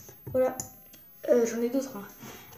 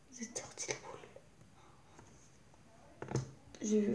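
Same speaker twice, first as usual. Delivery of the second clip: softer playful whisper; 12 dB quieter, faster than usual. Pretend. Girl teasing viewers trapped in the globe, whispering. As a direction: hush it like a secret.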